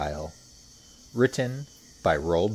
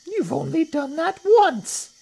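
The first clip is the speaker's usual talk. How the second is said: high, loud, slow little croc scoff; snorting little crocodile; 9 dB louder than usual. A narrator's reading. Not-so-big crocodile deflating a boast, high scornful voice. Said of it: "snorted the not-so-big one".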